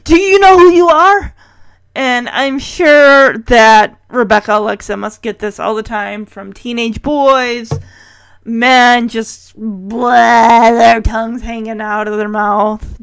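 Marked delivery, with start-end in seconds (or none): 0.0-1.3 loud, high-pitched gushing; starstruck fan. Retold or imitated. Host mimicking smitten Sean meeting a supermodel, starstruck. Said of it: "get the fire hose on old Sean here". 9.6-10.7 loud "blah" with tongue hanging out; imitated slack-tongued gawking noise; mock drooling noise. Host mocking men gawking at a model, drooling "blah".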